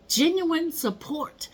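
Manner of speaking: ordinary delivery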